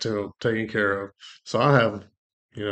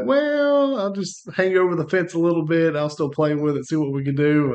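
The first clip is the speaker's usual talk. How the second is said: loud, much higher mocking voice; mock half-hearted believer; 7 semitones higher, 6 dB louder than usual. Mocking believers who won't let go: loud, high mock voice. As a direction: lampoon someone half-committed to God. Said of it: "You can't be like".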